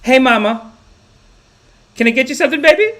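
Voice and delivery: high, sugary nurse, rising at the end; loving nurse impression. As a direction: gush lovingly at a patient, high-pitched. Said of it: "Just such loving".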